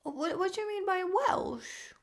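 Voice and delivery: ordinary delivery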